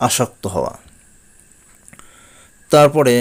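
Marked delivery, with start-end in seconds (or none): none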